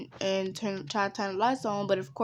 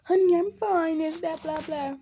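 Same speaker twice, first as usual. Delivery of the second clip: higher mom voice, a touch dismissive; lightly voiced mom; 8 semitones higher, 3 dB louder than usual. Mom telling worried kid she's fine, lightly dismissive. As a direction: brush it off in a higher voice. Voicing mom brushing her off.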